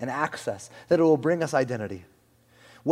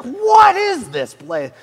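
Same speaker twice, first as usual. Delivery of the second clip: very loud, high, drawn-out disbelief; hammed-up cry of a flabbergasted king; astonished old Persian king; 12 semitones higher, 11 dB louder, much slower than usual. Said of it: "he'd be like".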